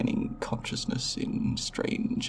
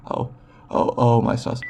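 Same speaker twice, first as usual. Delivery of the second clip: stammered oh, then a louder exclamation; startled alarm; 6 dB louder than usual. Acted. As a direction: caught off guard, rising in volume. Subject unexpectedly wakes during exam.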